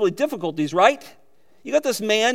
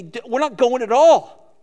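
mock desperate prayer; 4 semitones higher, 7 dB louder than usual. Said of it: "You just".

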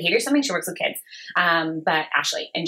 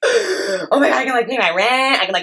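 retold giddy excitement, louder; thrilled; 4 semitones higher, 5 dB louder than usual. New photographer just paid three hundred dollars, thrilled. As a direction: gush over the windfall, laughing partway through. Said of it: "I was thrilled".

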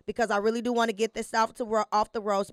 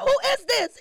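mock shout; 12 semitones higher, 5 dB louder than usual. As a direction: yell it, fake not knowing. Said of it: "I said".

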